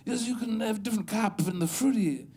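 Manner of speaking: lightly voiced snooty connoisseur, laughing; mock coffee snob. Comic mocking coffee snobs to the crowd, in a snooty put-on voice. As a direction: put on a precious foodie voice.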